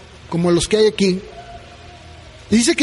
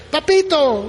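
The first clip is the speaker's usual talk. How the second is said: "Papito" called higher, delighted; happy child; 5 semitones higher than usual. Retold or imitated. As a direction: imitate a little girl running to her dad. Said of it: "Y corre".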